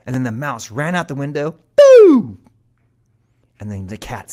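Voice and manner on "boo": very loud high-pitched whoop; escape noise. A narrator's reading. Mouse flees out the window, loud high zoom. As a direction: let out a huge zooming hoot.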